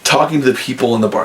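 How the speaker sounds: ordinary talk, nothing marked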